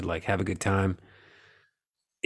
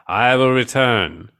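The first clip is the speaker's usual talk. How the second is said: slow, grand, drawn-out decree; slow godlike voice; 2 semitones higher, slower than usual. Retold or imitated. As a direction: intone it like an alien god. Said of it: "but he's like".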